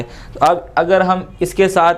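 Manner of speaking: ordinary talk, nothing marked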